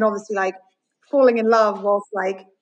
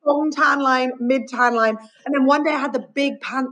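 ordinary talk, nothing marked

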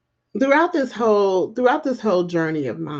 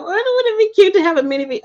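excited; 12 semitones higher, 3 dB louder, faster than usual. Real, not acted. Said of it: "Okay, let me focus".

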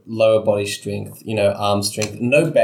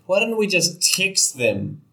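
exasperated; 8 semitones higher, slower than usual. Real, not acted. Player exasperated at missed easy option.

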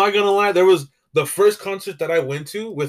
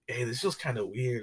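much softer, uneasy aside; quiet unease; 3 semitones lower, 12 dB quieter than usual. Retold or imitated. Going out after lockdown, a quiet uneasy aside. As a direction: say it quietly to yourself. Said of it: "I was like".

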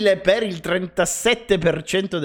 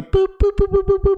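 high, clipped beeping sound; sensor beeps; 13 semitones higher than usual. Retold or imitated. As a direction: beep like a parking sensor. Imitating parking sensor beeps.

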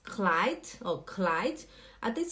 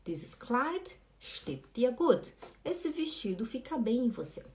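ordinary talk

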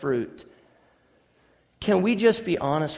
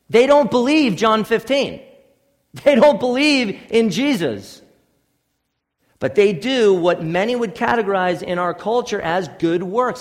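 higher, louder pulpit challenge; forceful preaching; 5 semitones higher, 6 dB louder than usual. Acted. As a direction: press the point on the hearers.

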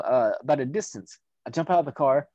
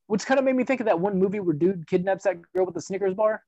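normal talking voice

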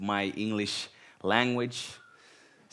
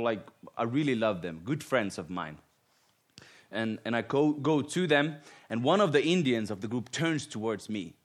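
ordinary talk

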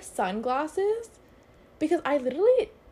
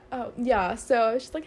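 ordinary talk

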